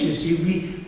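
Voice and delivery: normal talking voice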